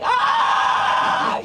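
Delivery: a startled laughing cry; shocked laughing shout. Retold or imitated. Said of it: "And David's like".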